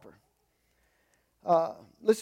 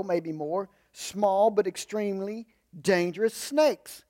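normal talking voice

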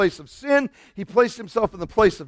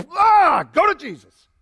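eager cry; 11 semitones higher, 4 dB louder than usual. Retold or imitated. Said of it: "it's like".

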